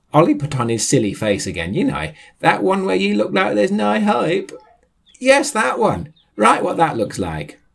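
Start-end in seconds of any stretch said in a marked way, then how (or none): 1.7-7.6 high-pitched complaining interjection; high indignant voice. Acted. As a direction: whine it up high.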